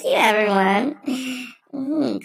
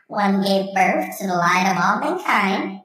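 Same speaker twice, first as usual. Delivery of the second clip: ordinary delivery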